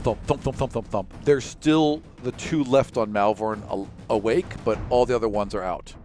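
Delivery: ordinary delivery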